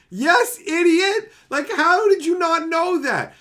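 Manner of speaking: high, loud yell of disbelief; loud exasperated rant. Real, not acted. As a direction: shout it at the idiot. Yelling at guy in video.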